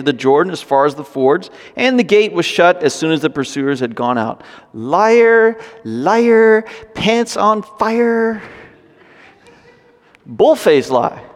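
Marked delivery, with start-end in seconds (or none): 4.7-8.4 high sing-song playground chant, slow on the end; preacher taunting Rahab like a child, high sing-song; childish taunt. Pretend. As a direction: chant it like a kid in the schoolyard.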